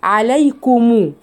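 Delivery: عليكم with a clear ضمة on the ميم, slow; ميم with damma. Shown as a demonstration. Teacher isolates the word for students. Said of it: "الميم هنا مضمومة".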